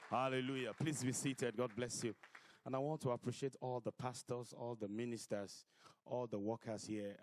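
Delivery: loud, ringing preacher voice; fiery preacher sermon. Acted. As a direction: preach it out to the hall. Preaching at full force.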